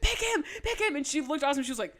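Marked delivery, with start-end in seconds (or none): 0.0-1.0 high, pleading yell; screaming. Retold or imitated. Fan begging the queen to choose his friend, high yell. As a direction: beg her to pick him, shouting. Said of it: "I was, like, screaming my ass off".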